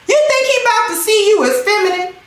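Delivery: usual speaking voice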